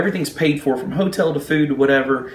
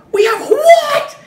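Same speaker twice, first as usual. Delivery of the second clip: very high, loud mock shriek, drawn out; ticked-off pilot; 8 dB louder, much slower than usual. Pilots react on the phone to the agent, hammed-up ticked shriek. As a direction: imitate an outraged squawk from the other end of the line. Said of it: "the pilots are ticked".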